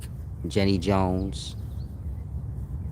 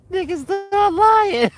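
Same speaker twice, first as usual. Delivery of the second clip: laughing; 4 dB louder than usual. Real, not acted. Laughing hard.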